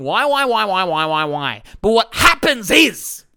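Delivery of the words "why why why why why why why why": rising-then-fading whiny chant; a hint of a broken record of self-pity; mock repeated why. Coach imitating practiced self-blame, whiny repeated whys. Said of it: "you get good at going".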